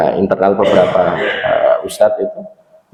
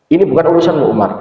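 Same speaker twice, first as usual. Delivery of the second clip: mild scolding; 6 semitones higher, faster than usual. Retold or imitated. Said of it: "Umar malah dimarahi".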